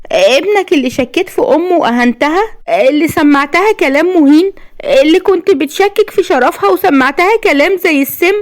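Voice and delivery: high, hurt woman's voice; tearful reproach. A narrator's reading. Woman blames him for insulting her, tearful reproach. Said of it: "وهي دموعها بتنزل وقالت بعتاب".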